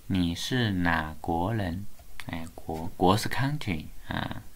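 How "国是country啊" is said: ordinary talk, nothing marked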